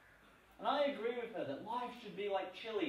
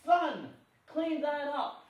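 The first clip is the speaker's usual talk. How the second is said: higher, louder and slow motherly order; stern mum; 8 semitones higher, 8 dB louder, much slower than usual. Retold or imitated. Mum ordering her messy son to tidy, loud, high and slow. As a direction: boom it out like a mother scolding her messy boy.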